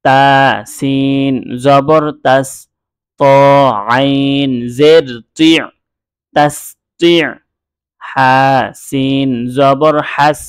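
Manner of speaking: each letter and vowel mark drawn out slowly; slow spelling drill. Shown as a demonstration. Teacher spelling Arabic letters for students, slow drill. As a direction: spell it out syllable by syllable like a Quran teacher. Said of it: "আচ্ছা, আবার পড়ছি".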